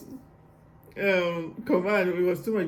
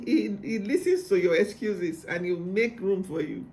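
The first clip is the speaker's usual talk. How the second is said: ordinary talk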